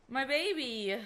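high, loud fangirl squeal; excited gushing. Real, not acted. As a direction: coo loudly over your darling. Gushes as favourite character appears.

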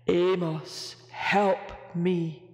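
a huge whale begging for help. A narrator's reading. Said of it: "said the mountain of a whale".

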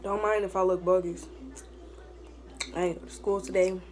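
ordinary talk, nothing marked

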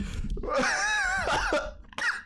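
squeaky rising laugh; wheezy high giggle; high giggling laugh. Real, not acted. Still laughing at blunder.

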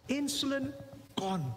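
ordinary talk, nothing marked

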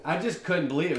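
usual speaking voice

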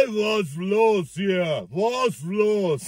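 put-on slow, slurred Russian accent; a drunk Russian asking what's up, slowly. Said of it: "Mit so einem russischen Akzent". (langsamer russischer Akzent, betrunken)

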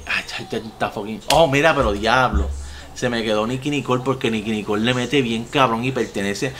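ordinary talk

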